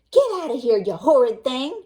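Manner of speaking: loud, high angry shout; granny yelling at the wolf; furious granny. Acted. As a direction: drive him out in a rage. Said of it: "she cried".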